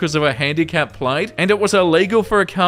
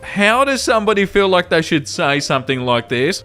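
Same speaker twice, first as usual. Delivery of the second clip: ordinary delivery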